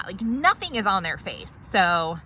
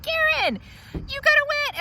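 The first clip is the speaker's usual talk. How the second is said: exasperated hygienist. Acted.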